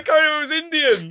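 high, loud mock Indian accent, laughing. Friend mocking the host's accent attempt in a mock Indian accent. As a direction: tease the take as an angry Indian accent. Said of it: "Sounds like an angry Indian".

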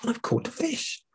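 high, soft squeaky rabbit; high little rabbit voice. Acted. Rabbit voice in story.

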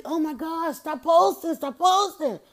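high, pleading "stop posting"; exasperated plea. Retold or imitated. Mocking parents posting stressed baby.

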